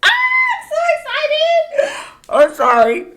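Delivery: high, loud scream of joy; real excited screaming. Real, not acted. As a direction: shriek with delight. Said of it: "I gotta stop screaming".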